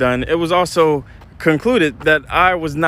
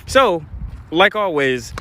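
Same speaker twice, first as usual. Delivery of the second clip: ordinary talk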